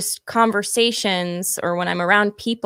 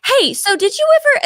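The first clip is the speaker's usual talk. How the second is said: chirpy chatter; 5 dB louder than usual. Retold or imitated. Acting out chatting during sex.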